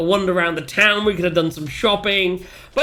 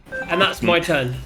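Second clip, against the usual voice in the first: ordinary delivery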